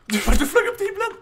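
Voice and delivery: very high, loud laughing shriek; astonished outburst. Real, not acted. Streamer seeing teammate fly skyward, shrieking in amazement. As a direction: squeal in disbelief through laughter.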